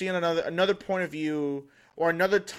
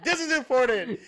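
higher-pitched retort through a laugh; mock indignant protest; 7 semitones higher than usual. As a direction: protest it high, half-laughing. Retells protest to his wife.

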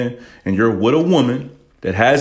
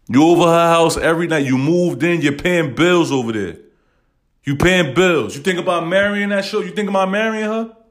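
heated rant; 8 semitones higher than usual. Real, not acted. Host scolding a man living with a single mom, heated. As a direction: lay into him, rising with each point. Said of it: "I get a little emotional when I say shit".

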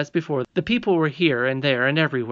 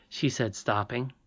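ordinary delivery

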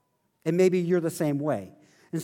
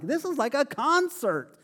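pitched up high in wide-eyed wonder; amazed; 8 semitones higher than usual. Retold or imitated. Stunned teen walking into the dark smoky service, amazed. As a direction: gasp it out like a kid at his first concert. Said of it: "I'm like".

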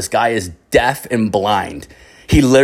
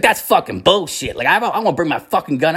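higher-pitched stubborn protest; heated complaint, retold in a raised pitch; imitated bitching; 6 semitones higher than usual. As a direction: act out an indignant griper digging in. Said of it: "the one guy was bitching".

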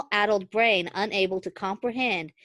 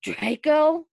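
dry croak, pitched up; 8 semitones higher, 4 dB louder than usual. Acted. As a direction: rasp it out startled. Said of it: "I croaked".

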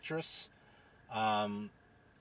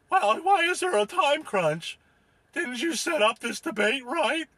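high, put-on Tasmanian professor voice; 10 semitones higher, 4 dB louder than usual. Professor challenges host over time crunch, high Tasmanian voice. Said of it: "down in Tasmania there, Professor Clownius".

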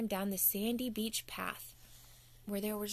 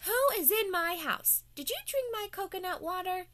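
suspicious fairy; 8 semitones higher than usual. Acted. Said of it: "she heard it again".

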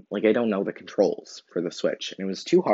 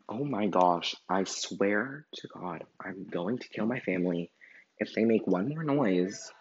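annoyed mutter; 7 dB quieter than usual. Real, not acted. Annoyed at noisy family.

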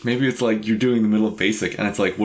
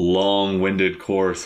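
slow pun delivery; much slower than usual. Pretend.

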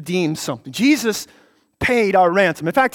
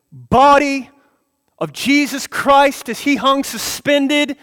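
louder, higher impassioned preaching; preaching at full force; 6 semitones higher, 5 dB louder, slower than usual. Acted. Preacher urging believers to picture the cross, impassioned. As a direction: pour it out, slow and swelling, over the cross.

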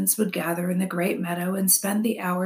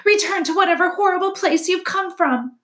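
high, loud, scolding squeak; 12 semitones higher, 6 dB louder than usual. A narrator's reading. Weasel tells the robot to go back, squeaking. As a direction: squeal the dismissal at the intruder. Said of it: "squeaked Dart as he crouched low".

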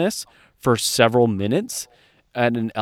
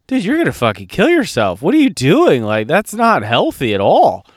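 exasperated yell; 5 semitones higher, 6 dB louder than usual. Retold or imitated. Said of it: "And I'm like".

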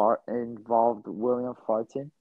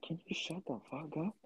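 fed up; 4 semitones higher, 14 dB quieter than usual. Retold or imitated. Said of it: "he got so tired of it".

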